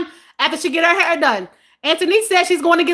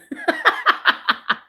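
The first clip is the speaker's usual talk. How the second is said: high giggle; 3 dB quieter than usual. Real, not acted. Host teasing newcomers to chat, high laughter. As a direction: run of high-pitched laughter. Amused while addressing new viewers.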